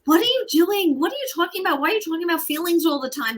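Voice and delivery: put-on high, fast, exasperated teen protest; high, fast daughter freaking out over feelings talk. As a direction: whine it like a baffled eleven-year-old.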